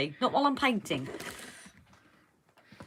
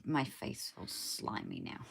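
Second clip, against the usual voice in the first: whispered; 9 semitones lower, 15 dB quieter, slower than usual. Real, not acted. Muffled after hand over mouth.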